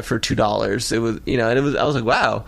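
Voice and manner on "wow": usual speaking voice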